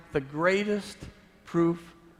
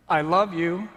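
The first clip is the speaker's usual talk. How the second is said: loud, higher I love you; impassioned cry; 4 semitones higher, 8 dB louder than usual. Acted. Jesus to the onlooker on the cross, loud impassioned cry. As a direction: cry it out through the pain. Jesus speaks as spike pierces.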